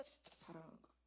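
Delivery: soft whispered conclusion. Real, not acted. Preacher whispering to the congregation after the outburst. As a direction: drop to a whisper. Hushed after emphatic words.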